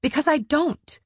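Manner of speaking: sharp, high-pitched retort; snapped. A narrator's reading. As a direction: snap it back defensively. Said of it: "she snapped".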